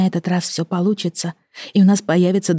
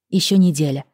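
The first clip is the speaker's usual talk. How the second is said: ordinary delivery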